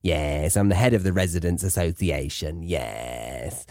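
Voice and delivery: low busybody voice, sinking on the last word; self-important residents' association head, low; Dufresne Constantinople. Acted. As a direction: drop into a low comic busybody voice. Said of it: "is known to be the head".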